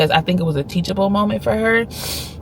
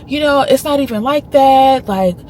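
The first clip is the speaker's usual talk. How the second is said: higher, slower pleading sorry; mock repeated apology; 7 semitones higher, 4 dB louder than usual. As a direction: keep apologizing over and over. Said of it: "she just kept apologizing".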